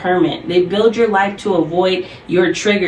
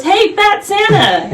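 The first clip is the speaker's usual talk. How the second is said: put-on much higher and slow, a small kid calling out; little sister's voice; 13 semitones higher, 3 dB louder, slower than usual. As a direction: squeal it out like a five-year-old spotting Santa. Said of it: "She goes".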